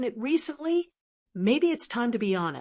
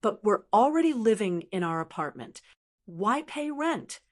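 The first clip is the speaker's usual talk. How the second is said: ordinary delivery, nothing marked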